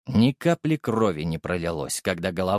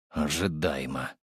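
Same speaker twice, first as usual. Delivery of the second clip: through gritted teeth; 8 semitones lower, 6 dB quieter than usual. A narrator's reading. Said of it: "процедил на это сквозь зубы".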